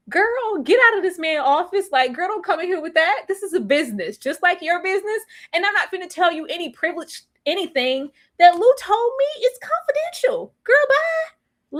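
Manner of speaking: high, indignant clapback; sassy therapist. Retold or imitated. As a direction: put her in her place, high and sharp. Therapist throwing Raquel out.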